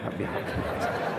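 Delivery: breathy whisper of the prayer. Retold or imitated. Preacher shows a father whispering prayer over his child. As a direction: whisper it lightly. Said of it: "Jami kalau bapaknya".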